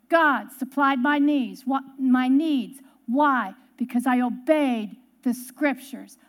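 measured, emphatic sermon close; solemn preaching. Real, not acted. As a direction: drive the lesson home slowly, word by word. Preacher's closing point.

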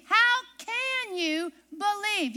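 HOW stretched slowly; slow and drawn out. Shown as a demonstration. Preacher slowing down to press the question on her congregation. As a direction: drag the question out for weight.

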